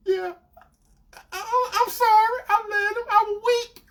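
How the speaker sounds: very high, whimpering girlfriend imitation; mock tearful apology. Girlfriend apologizing to him, imitated tearfully. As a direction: plead in a high mock cry.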